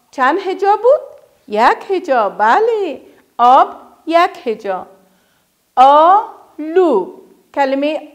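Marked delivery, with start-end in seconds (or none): 5.8-7.1 کشیده و آهسته; drawn out slowly. Shown as a demonstration. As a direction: stretch the word very slowly. Teacher sounding word syllable by syllable.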